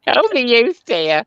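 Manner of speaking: put-on high, quavering old dear voice; mock old granny clucking; mock old lady. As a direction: play a fussy old woman tutting at the youth. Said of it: "you sounded old when you said that".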